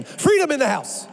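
high, loud preacher's cry; triumphant shout. Real, not acted. Preacher proclaiming freedom to the congregation, triumphant shout.

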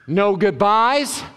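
usual speaking voice

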